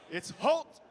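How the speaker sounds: ordinary delivery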